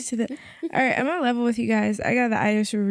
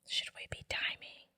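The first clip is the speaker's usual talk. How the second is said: whispering; 16 dB quieter than usual. Real, not acted. Whispered aside.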